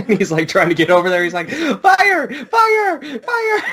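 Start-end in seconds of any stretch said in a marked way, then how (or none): 1.5-3.6 very high, falling cries of fire; hammed-up high alarm calls; high mock alarm. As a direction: shriek fire three times in mock panic. Character shouting about fire.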